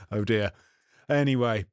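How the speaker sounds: ordinary talk, nothing marked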